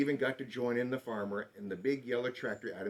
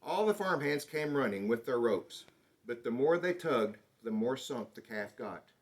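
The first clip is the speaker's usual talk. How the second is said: ordinary delivery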